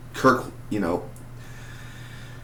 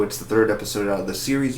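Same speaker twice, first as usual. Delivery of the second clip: ordinary delivery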